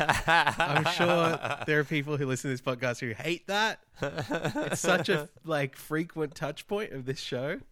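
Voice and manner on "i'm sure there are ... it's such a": laughing. Real, not acted. Laughing while talking.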